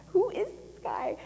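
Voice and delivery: high, laughing disbelief; incredulous. Retold or imitated. Guest wondering about the Russian stranger, incredulous. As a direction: squeak it in amused disbelief.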